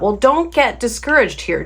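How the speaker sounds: usual speaking voice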